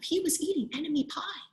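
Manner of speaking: usual speaking voice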